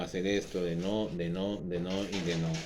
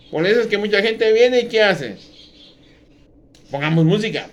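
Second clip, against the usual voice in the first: loud, fervent pulpit delivery; fiery sermon voice; preaching at full force; 11 semitones higher, 12 dB louder than usual. Acted. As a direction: thunder it out to the congregation.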